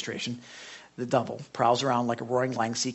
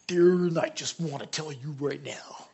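gruff booming lumberjack. Retold or imitated. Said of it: "he talks with this big lumberjack voice".

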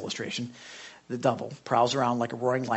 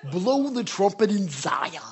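slow, louder booming lumberjack read; gruff booming lumberjack; 5 semitones higher, 4 dB louder, slower than usual. Retold or imitated. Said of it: "I almost have to read it in his voice".

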